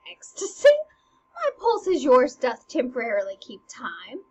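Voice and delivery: indignant disbelief. Acted. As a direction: throw the accusation back in disbelief. Hamlet indignant at madness charge.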